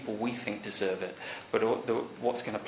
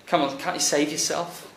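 mocking Pharisees; 6 semitones higher, 3 dB louder than usual. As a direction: taunt him like a sneering crowd. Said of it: "are mocking him, saying".

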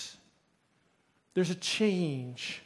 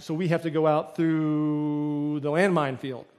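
slow, drawn-out disbelief; incredulous question; 3 semitones lower, slower than usual. Retold or imitated. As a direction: stretch it out, dreading the answer. Retold disbelief at escape route.

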